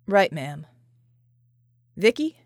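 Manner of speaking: a lightly voiced tough soldier answering his commander; John's voice. A narrator's reading. John's lines in dialogue.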